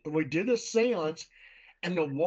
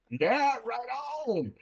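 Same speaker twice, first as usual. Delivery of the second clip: high, drawn-out comeback; retold cocky reply; 7 semitones higher, slower than usual. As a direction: stretch it out high and slow. Retold answer to spend-the-night dare.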